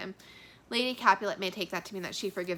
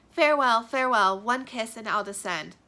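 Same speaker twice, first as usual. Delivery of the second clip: loud, earnest farewell read aloud; dramatic reading of a parting lover; Romeo's farewell; 2 semitones higher, 9 dB louder than usual. Acted. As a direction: call farewell up to her.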